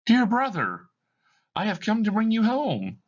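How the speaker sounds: put-on child calling her brother home; little girl Fan. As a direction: play a six-year-old sister. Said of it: "a young girl enters".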